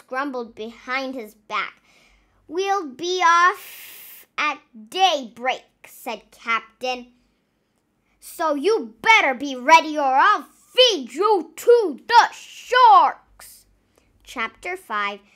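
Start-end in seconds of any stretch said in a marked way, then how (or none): 2.5-13.7 loud, slow menacing captain; threatening bellow. Acted.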